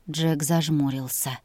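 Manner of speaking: usual speaking voice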